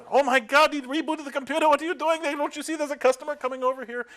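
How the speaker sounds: high-pitched imitation of panicked screaming; mock screaming. Engineers panic as a customer approaches, high mock scream. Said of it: "they started screaming and throwing up their hands".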